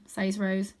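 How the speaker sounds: ordinary delivery, nothing marked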